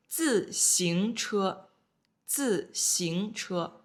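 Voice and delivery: 自行车 said slowly, each syllable drawn out; slow vocabulary model. Shown as a demonstration. Teacher reading new word to students, slow and drawn out. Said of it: "Next one".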